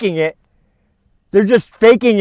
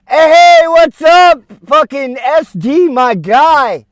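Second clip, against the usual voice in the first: calling out; 4 semitones higher, 7 dB louder, slower than usual. Real, not acted. Calling out to passer-by.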